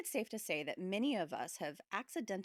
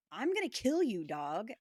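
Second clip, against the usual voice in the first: raised voice, mom yelling; mock yell; 4 semitones higher, 6 dB louder than usual. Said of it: "she yelled".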